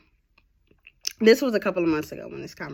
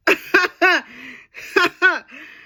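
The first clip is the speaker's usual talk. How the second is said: high loud burst of laughter; 13 semitones higher, 9 dB louder than usual. Real, not acted. Laughing at her own joke to listeners, loud burst.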